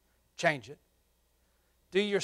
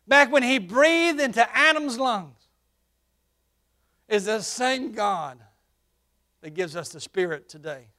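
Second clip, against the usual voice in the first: higher, louder sermon, slowing on key phrases; preaching at full force; 7 semitones higher, 10 dB louder than usual. Acted. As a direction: proclaim the same God then and now. Preaching raised.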